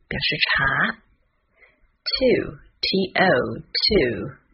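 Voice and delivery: slow, spelling each word out letter by letter; unhurried spelling drill; slow spelled words. Shown as a demonstration. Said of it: "我们来看一下发音的部分".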